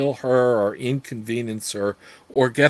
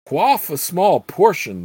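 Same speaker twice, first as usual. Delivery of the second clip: commanding; 6 semitones higher, 5 dB louder than usual. Acted. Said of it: "the Grand Count commanded".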